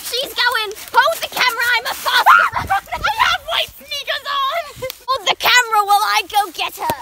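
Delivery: high, loud, excited yelling; excited shouting. Acted. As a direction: shout while giving chase. Chasing the runaway creature.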